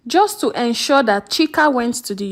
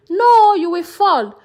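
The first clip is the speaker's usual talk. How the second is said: raised, louder alarmed cry; shouting; 6 semitones higher, 4 dB louder than usual. A narrator's reading. Mother shouting to stop her son climbing, alarmed. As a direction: call out sharply in alarm to stop him. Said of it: "his mother would rush out shouting".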